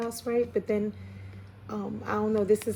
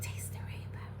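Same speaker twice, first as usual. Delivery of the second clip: barely voiced, very soft whisper; whispered slogan; 11 dB quieter than usual. Reader relaying a slogan that just came to mind, whispered. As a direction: whisper it like a message overheard. Echoing a phrase just heard.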